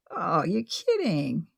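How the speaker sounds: slow, soft sleepy whine; just woken, whining; groggy whine. A narrator's reading. Woken early, complaining.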